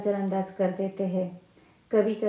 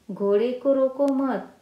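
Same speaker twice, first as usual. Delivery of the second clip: loud, higher "रोको मत" as one falling phrase; no pause before मत; 4 semitones higher, 5 dB louder than usual. Shown as a demonstration. Teacher contrasting the second sentence, मत attached to रोको. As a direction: join मत firmly onto रोको, stopping after मत. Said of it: "और दूसरे वाक्य में हम".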